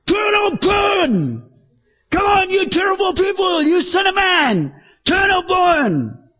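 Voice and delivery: high, bellowing hellfire cry, dragged out slow; street preacher shout. Retold or imitated. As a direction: roar it at the crowd like a wild prophet. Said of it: "He was probably shouting out".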